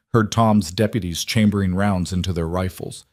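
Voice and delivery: ordinary delivery, nothing marked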